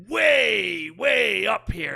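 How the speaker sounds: loud, high, drawn-out WAY; over-the-top shout. Shown as a demonstration. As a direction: shout it, stretching each "way". Illustrates going over the top.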